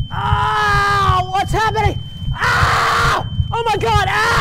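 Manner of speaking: high, loud yelps of agony; panicked screaming in pain, hammed-up; sudden great pain. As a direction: cry out as if pain just struck. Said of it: "it gives me great pain".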